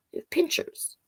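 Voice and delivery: breathy whispered repeat; whispered aside. Real, not acted. Reading to kids, whispering the repeat. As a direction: whisper it to yourself.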